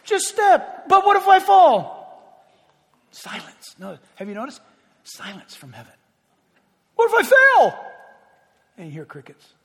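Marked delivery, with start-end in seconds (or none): 0.0-0.9 God's voice, shouting a firm order; firm command. Acted. God calling the believer to just step, loud command. 0.9-1.9 high, loud panicked plea; fearful cry. Acted. Believer crying out to God, afraid to fall, high and loud. 7.0-7.8 high, loud panicked plea; fearful cry. Acted. Believer crying out to God, afraid to fall, high and loud.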